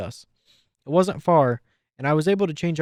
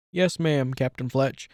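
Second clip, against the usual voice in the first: plain reading voice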